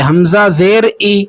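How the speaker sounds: slow recital, ای as a clear "ee"; teacher spelling out حمزہ زیر ای slowly, ending in "ee"; ma'ruf vowel. Shown as a demonstration. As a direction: say ای with a pure long "ee" sound. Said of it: "یعنی اس طریقے سے پڑھا جائے".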